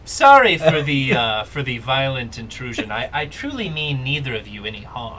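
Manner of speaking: loud little frog creature; a hint of a tiny frog man. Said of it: "And he goes".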